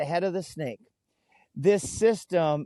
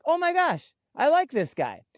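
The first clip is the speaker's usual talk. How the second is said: delighted surprise; 8 semitones higher than usual. Retold or imitated. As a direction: jump high with glad amazement. Delighted by polite official.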